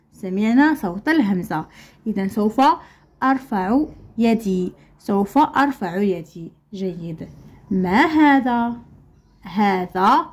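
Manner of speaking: ordinary talk, nothing marked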